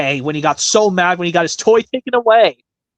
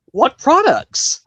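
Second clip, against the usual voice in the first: high, slow, baffled; mock bewildered sitcom line; puzzled question; 9 semitones higher, much slower than usual. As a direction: drag out the question in disbelief. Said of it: "do a different impression".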